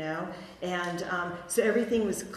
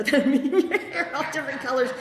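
laughing; 7 semitones higher, 8 dB louder than usual. Real, not acted. Laughing at childhood hair marbles.

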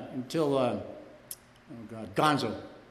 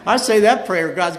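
loud, breaking into laughter; laughing; 5 semitones higher, 12 dB louder than usual. Real, not acted. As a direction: laugh through the words. Laughing at own story.

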